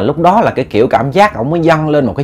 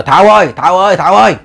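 mildly raised calling voice; 6 semitones higher, faster than usual. A narrator's reading. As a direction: call a neighbour by name, lightly acted. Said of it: "Ông mới kêu".

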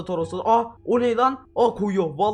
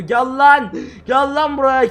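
loud, higher yell; 5 semitones higher, 6 dB louder, slower than usual. Real, not acted. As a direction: shout after him. Chasing the other player. (yüksek sesle bağırış)

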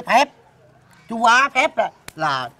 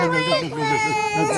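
panicked pleading. Acted. Uncle panics, hushing crying child.

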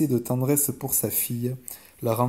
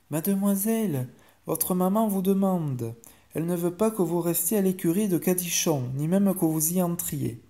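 higher-pitched servant voice, measured; formal maid, pitched high; chambermaid delivering orders; 5 semitones higher than usual. Acted. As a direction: relay the mother's orders politely but firmly.

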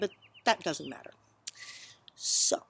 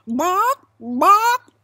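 two loud, high rising clucks; high-pitched "bawk, bawk"; chicken squawks; 12 semitones higher, 14 dB louder than usual. Acted. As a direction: cluck twice like an insistent hen. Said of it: "The chicken points at the book".

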